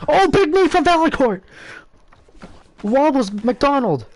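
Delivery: excited. Real, not acted. Big knee lands in fight.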